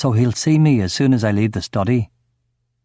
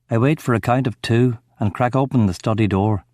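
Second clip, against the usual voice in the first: ordinary delivery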